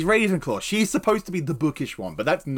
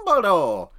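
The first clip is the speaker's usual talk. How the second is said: louder, falling comic cry; puppet-show Dumbledore; 3 semitones higher, 5 dB louder than usual. Pretend. Cohost answering own Snape chant, loud comic cry. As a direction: call the name out over the chant.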